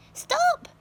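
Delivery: very high, loud stop; sudden cry. A narrator's reading. Aunt Spiker stopping James at the trunk. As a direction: yelp the word out. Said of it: "Aunt Spiker said quickly".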